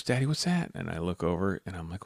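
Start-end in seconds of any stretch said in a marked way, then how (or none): 0.0-0.7 lightly voiced little girl, higher; a hint of a curious child's high voice; imitating daughter. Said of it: "And my daughter goes".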